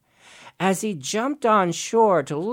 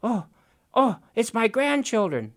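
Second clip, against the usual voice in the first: pleased Raven; 6 semitones higher than usual. Acted. Raven hears birds.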